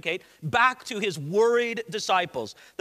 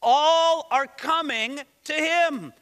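higher, drawn-out cry of mock alarm; hammed-up alarmed complaint; 5 semitones higher, 4 dB louder, much slower than usual. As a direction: stretch it out like a dismayed disciple. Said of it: "They were alarmed".

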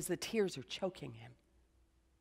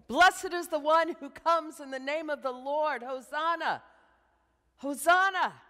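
high, loud cry of jubilant praise; joyful crowd shout; 8 semitones higher, 7 dB louder than usual. Retold or imitated. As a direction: call out like a rejoicing crowd. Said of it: "hear the crowd shouting with joy".

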